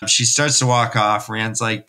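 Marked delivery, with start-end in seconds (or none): none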